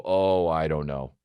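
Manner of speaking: low, slow mock-reluctant reply; helpless mayor. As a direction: sink into a low, slow answer. Helpless mayor answers the townsperson.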